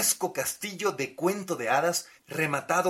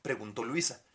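usual speaking voice

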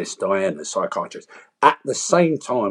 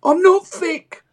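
much higher and louder, slow and pleading; inner child crying out; 5 dB louder, slower than usual. Retold or imitated. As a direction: shout it out like the hurt little kid inside. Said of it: "I'm shouting out to everyone".

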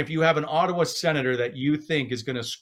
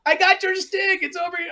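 very high-pitched mock yell; imitating Dom yelling. Goalie yelling to a reporter about a signed stick, high mock yell. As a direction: call out high, imitating an excited athlete. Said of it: "it's Dom yelling".

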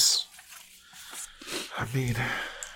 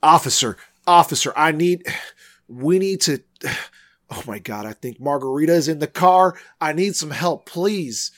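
high, halting cry for help; panicked plea; 6 semitones higher than usual. Acted. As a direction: plead desperately, breaking off and restarting. Begging officer for help at wreck.

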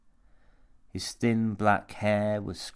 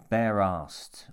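usual speaking voice

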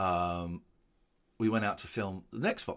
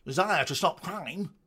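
mimicking a posh news voice, pitched up; 5 semitones higher than usual. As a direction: say it like a posh newsy guy. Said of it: "very posh".

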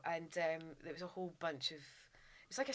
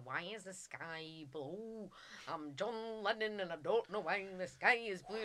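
slow, drawn-out mock Liverpool accent; bad Liverpool accent; slower than usual. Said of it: "trying to do a Liverpool accent".

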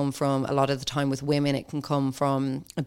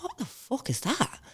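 hushed, high-pitched disbelief; retold bewilderment under the breath; baffled reaction; 8 semitones higher, 9 dB quieter than usual. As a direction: softly act out being baffled.

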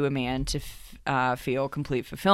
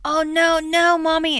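put-on much higher, louder whimpering child; whiny child voice; 6 dB louder, slower than usual. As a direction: play a little kid pretending to be hurt. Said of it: "Max kept saying".

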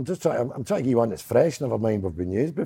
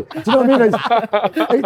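normal talking voice

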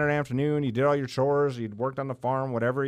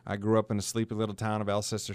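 normal talking voice

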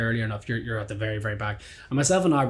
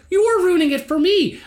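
loud, high, exasperated retort; indignant comeback; 5 dB louder than usual. Retold or imitated. Gig-goer turning the blame back on the drummer, outraged. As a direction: throw the accusation back, pitched way up. Retold argument, throwing accusation back.

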